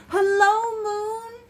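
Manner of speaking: high, drawn-out storybook shout; shouted greeting. A narrator's reading. Bear calling from a treetop to the moon, shouted. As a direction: call up to the moon, high and loud. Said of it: "he shouted".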